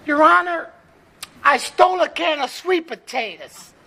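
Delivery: high, loud wife voice; put-on high wife voice; wife addressing judge. Wife pleading to judge in joke.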